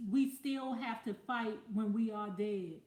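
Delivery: ordinary delivery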